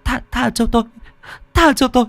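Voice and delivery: high, halting cry of terror; panicked pleading. A narrator's reading. Terrified wife begging the ghost to let go, panicked. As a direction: beg for mercy in a thin, stammering voice. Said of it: "Cô hốt hoảng".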